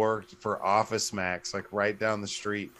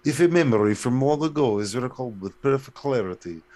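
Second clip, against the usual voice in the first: louder put-on Russian accent; 5 dB louder than usual. Co-host re-reading the riddle after accent talk, Russian accent. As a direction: recite it like Russian state TV. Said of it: "on Russian T V".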